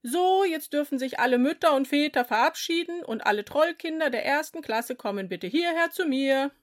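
calling the class over; teacher calling out. A narrator's reading.

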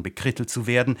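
plain reading voice

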